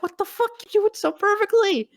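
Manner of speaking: very high, stunned squeal; mock shock at a perfect impression; amazed praise. [name] reacting to the impression, very high squeal. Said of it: "He's just like".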